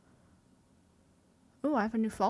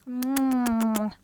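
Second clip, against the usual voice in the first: long, loud kiss noise; blown kiss; 2 semitones lower, 7 dB louder than usual. Real, not acted. Saying goodbye to chat, long loud blown kiss. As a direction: hum into a big loud smack.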